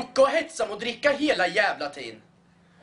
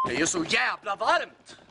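ordinary talk, nothing marked

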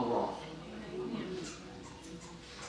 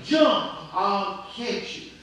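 insistent retold yell; 3 semitones higher, slower than usual. Preacher voices a dad who keeps calling to his trapped son, insistent yell. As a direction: imitate a father calling up more urgently. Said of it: "his dad kept yelling".

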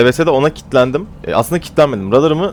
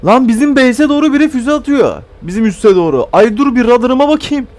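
high, loud imitation of what a sensible player would say; mock sensible pilot; 12 semitones higher, 3 dB louder than usual. Showing how a teammate should react, mocking pilot voice. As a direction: act out the teammate checking his radar. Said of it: "İnsan bir der".

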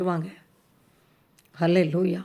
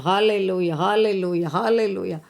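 ordinary delivery

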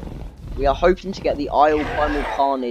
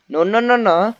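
raised, loud string of panicked no's; urgent, louder plea to keep back; alarmed warding off; 6 semitones higher, 10 dB louder than usual. Real, not acted. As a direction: fend it off, high and loud. Player pleads with attacking raptor.

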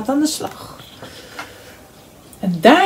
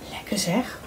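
breathy unvoiced whisper of delight; 11 dB quieter than usual. Frog whispering to himself over the pie, hushed. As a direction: whisper it like a secret treat.

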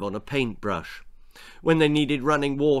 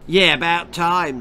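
put-on callous girl; 4 semitones higher, 6 dB louder than usual. A narrator's reading. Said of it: "said Girl".

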